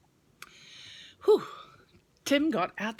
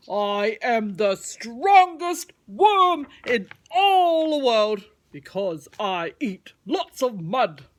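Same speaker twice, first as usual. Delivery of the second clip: louder, slow proud worm voice; 2 semitones higher, 9 dB louder, slower than usual. Acted. Mudwiggle the worm introducing himself, slow and proud. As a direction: announce your name slowly and proudly.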